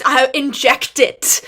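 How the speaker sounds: bursting with fan glee; gushing delight. Real, not acted. Fan describing the show, excited gush. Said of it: "like, I just".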